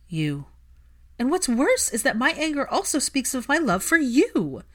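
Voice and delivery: loud and high, climbing to breaking; declaring love through anger, rising loud; impassioned outburst. Acted.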